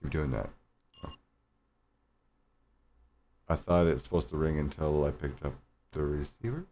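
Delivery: usual speaking voice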